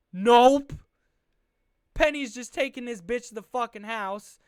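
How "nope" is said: a loud, rising, mocking nope; loud scoffing nope. Real, not acted. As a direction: bark it like a sneering fan. Mocking the missed tackle.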